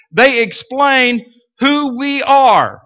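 rising, emphatic pulpit preaching. Acted. Preaching builds intensity.

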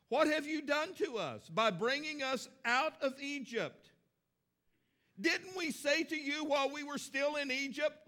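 a touch of whiny grumbling; whiny people. Retold or imitated. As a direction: complain like a whiny congregation. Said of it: "it sounds a little whiny".

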